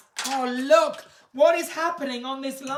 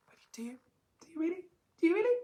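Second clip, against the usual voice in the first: teasing; 7 semitones higher, much faster than usual. Real, not acted. Teasing question building.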